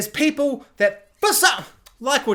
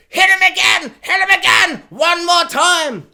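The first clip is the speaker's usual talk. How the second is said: higher, louder eager shout; mock shouting fans; 8 semitones higher, 7 dB louder than usual. Fans urging Disney on, loud mock shout. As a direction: cheer on the whipping. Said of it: "they're all just like".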